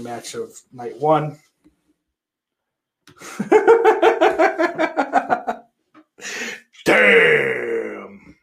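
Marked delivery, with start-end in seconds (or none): 3.2-5.6 high burst of laughter, falling away; loud laugh. Real, not acted. Streamer bursting out laughing at chat, loud laugh. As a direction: crack up loud at a chat message.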